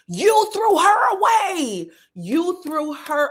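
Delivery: high, loud, slow outraged shout. Real, not acted. Reacting to the show, shouts high and slow at the cast. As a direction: call her out, loud and slow. Yelling at the reunion footage.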